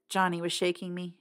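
plain reading voice

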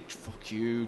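usual speaking voice

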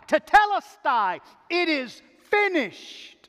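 high, ringing cry that slows; Jesus crying out. Acted. Preacher as Jesus at the close of redemption, crying out. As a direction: cry it out loud, then draw out the last words. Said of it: "Jesus cry out".

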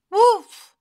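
high, loud lamb's call; crying out. A narrator's reading. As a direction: call out high as a little lamb. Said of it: "she cried".

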